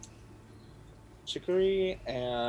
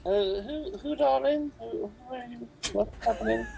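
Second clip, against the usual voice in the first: higher, bewildered granny voice; flustered old woman, lost; confused old lady; 11 semitones higher than usual. Acted.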